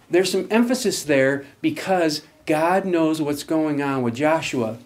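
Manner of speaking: ordinary delivery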